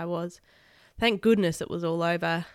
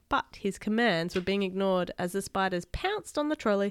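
plain reading voice

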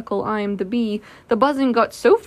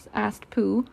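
ordinary delivery, nothing marked

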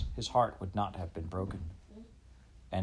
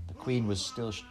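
usual speaking voice

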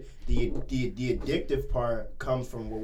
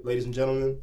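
ordinary talk, nothing marked

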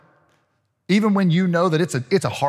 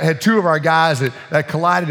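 ordinary talk, nothing marked

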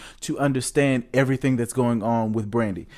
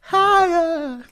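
high, louder call-out; 4 dB louder than usual. Pretend. Hyping singer again.

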